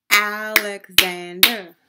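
Alexander in four loud beats, Al-ex-an-der; syllable by syllable. Shown as a demonstration. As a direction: punch out each syllable of Alexander. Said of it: "we have four syllable word".

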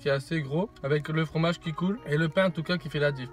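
talking between bites; chewing while talking. Real, not acted. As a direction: mouth partly full of food. Seen 1: Left man chews with bulging cheeks while talking, holding sandwich.

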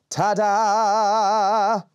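loud, sung-out ta-da; proud shout. Acted. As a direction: belt it out proudly. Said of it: "it shouted proudly".

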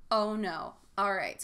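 normal talking voice

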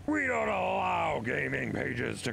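streamer guy. Retold or imitated. To the streamer who banned him, a lightly acted stern voice. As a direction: quote the streamer laying down the rule. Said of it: "and he's like".

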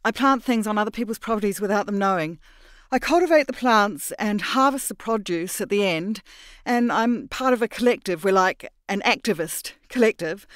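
gabbling a cover story in fear; nervous babble. Acted. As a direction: Mira rushing her excuses to the stranger, panicky and fast.

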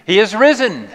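loud proclamation of risen; Easter call. Acted. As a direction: call it out to the room for the response.

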